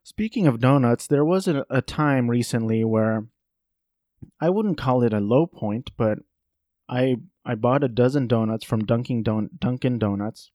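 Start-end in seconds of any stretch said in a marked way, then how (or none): none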